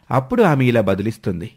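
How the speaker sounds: ordinary delivery, nothing marked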